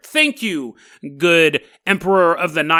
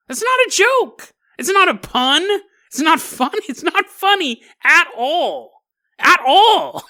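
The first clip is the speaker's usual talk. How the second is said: high, exasperated disbelief, laughing through it; high-pitched mock outrage at a bad joke; 10 semitones higher, 4 dB louder than usual. Real, not acted. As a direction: protest that it isn't funny at all. Exasperated rant about bad joke.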